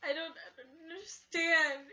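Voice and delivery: high, loud tearful wail; a crying repeat of the confused line. Retold or imitated. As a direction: sob the words out. Same line, now crying.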